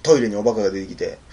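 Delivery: plain reading voice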